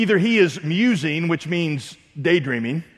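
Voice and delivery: usual speaking voice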